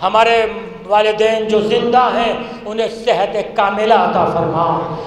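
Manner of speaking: hushed closing dua; prayer. Acted. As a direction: pray quietly for the departed. Closing dua after majlis.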